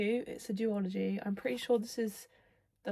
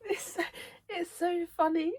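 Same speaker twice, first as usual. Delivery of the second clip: giggly delight; 9 semitones higher than usual. Real, not acted. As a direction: squeal it through leftover giggles. Giggling over the banter.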